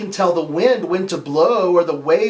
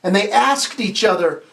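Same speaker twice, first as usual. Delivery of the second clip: normal talking voice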